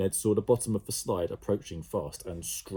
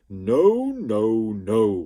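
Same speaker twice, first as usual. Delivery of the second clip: sloot refusing; 2 semitones higher, 10 dB louder, much slower than usual. A narrator's reading. The sloot refusing Jemima, loud and gleeful. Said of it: "replied the sloot".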